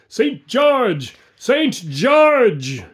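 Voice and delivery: loud, high rallying shout; shouted war cry. A narrator's reading. Phantom army answering the summons, shouted war cry. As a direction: roar it like a thousand men. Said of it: "thousands shouting".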